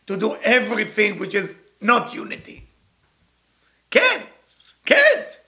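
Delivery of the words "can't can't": emphatic no. Acted. Forbidding, repeated for emphasis.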